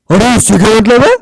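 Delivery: mock angry comeback. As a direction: snap back in a silly voice. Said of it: "तुम्हारी आवाज को क्या हुआ यार".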